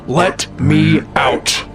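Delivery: slow ghostly cry from behind a wall; eerie drawn-out plea. Acted. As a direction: stretch it out like a trapped spirit. Voice from inside the wall.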